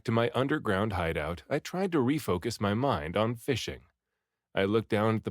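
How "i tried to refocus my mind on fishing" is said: usual speaking voice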